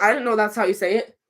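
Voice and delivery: ordinary talk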